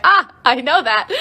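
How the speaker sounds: loud, laughing burst of recognition; excited recognition. Retold or imitated. Streamer spotting Russian in the comments, excited recognition. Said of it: "I was like".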